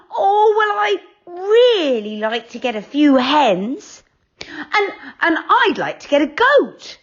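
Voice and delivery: high, eager wishing; eager girls. A narrator's reading. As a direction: list wishes excitedly. Girls voiced.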